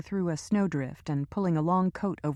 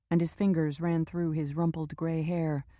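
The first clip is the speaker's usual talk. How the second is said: ordinary delivery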